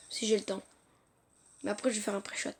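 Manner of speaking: whispered aside, very soft; aparté à voix basse. Real, not acted. (aparté chuchoté)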